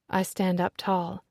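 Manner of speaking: plain reading voice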